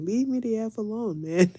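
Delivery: imitating her own fed-up cussing; retold cussing. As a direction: snap at a husband, half in jest. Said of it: "I started cussing at him".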